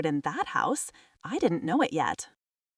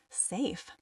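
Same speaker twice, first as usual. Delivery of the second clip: much softer, sceptical echo of SAFE; quiet doubt; 8 dB quieter than usual. A narrator's reading. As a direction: drop SAFE to a small doubtful murmur. Narrator echoes the word in doubt.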